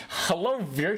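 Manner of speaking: ordinary talk, nothing marked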